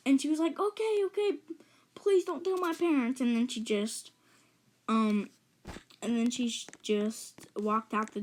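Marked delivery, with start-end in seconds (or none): 0.6-3.2 high, hurried-sounding submission; mock frantic pleading. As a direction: give in and beg, imitating the babysitter. Babysitter gives in, begging.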